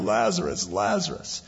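high, then fading to a breathy whisper; a grieving sister's call trailing off; mournful calling. Retold or imitated. Mary and Martha calling for their dead brother, fading grief. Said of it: "kept crying out".

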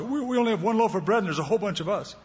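fast, fretful whining; worried disciples. Retold or imitated. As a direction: panic about the bread. Disciples fretting over bread.

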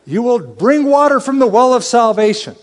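high, ringing communal shout; lifted, full-voiced joyful cry; crowd shout. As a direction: call it out like a whole crowd shouting. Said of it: "Everybody would shout".

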